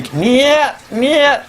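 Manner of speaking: high mock Russian scream of no; mock Russian screaming down the phone. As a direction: shriek it like a panicked Russian. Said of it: "started screaming".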